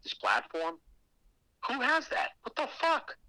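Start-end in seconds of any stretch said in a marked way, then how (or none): 1.7-3.2 shocked disbelief. Retold or imitated. Retelling shock at the stage.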